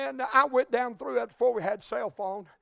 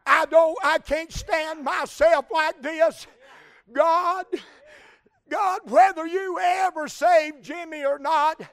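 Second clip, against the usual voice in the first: loud, raised-pitch pleading; impassioned acted prayer; 4 semitones higher, 12 dB louder than usual. Woman at the altar praying about her anger at God, impassioned. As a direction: cry out to God, surging on key phrases.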